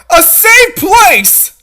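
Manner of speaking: high, loud, slow reply; urgent shout. Acted. Rescuer shouts back while running. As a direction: shout the answer.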